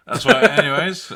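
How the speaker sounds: high and loud, caught laughing; laughing burst. Real, not acted. Cohost cracking up over a guilty pleasure. Laughing at the Minecraft movie pick.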